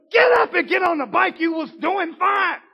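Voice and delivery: put-on loud, high scolding at the child; harsh parent yell. Parent yelling at a fallen child on the bike, loud. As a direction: bark at the crying kid. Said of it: "You don't go".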